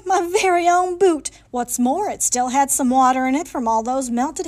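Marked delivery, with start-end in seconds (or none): 0.0-1.3 high, delighted exclamation; joyful whoop. A narrator's reading. Narrator finding own boot in the desert, joyful whoop. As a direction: whoop it out with joy. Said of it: "I whooped for joy".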